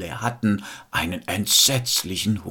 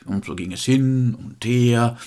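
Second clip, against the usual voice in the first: ordinary delivery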